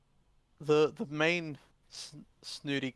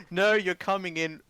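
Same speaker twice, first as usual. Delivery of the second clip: insistent uncle, higher and louder; forceful uncle; 5 semitones higher, 5 dB louder than usual. Acted. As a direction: put your foot down as the uncle. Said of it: "forcefully grabs him and drags him".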